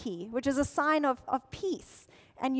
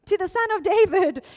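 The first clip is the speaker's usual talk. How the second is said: raised, high crowd shout; 5 semitones higher, 8 dB louder than usual. Retold or imitated. Crowd hailing the Son of David, shouting. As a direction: keep the crowd's loud cry going. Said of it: "they start shouting".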